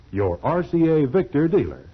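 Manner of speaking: normal talking voice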